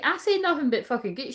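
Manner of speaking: insist, offended, on your innocence; indignant. Retold or imitated. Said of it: "I was like".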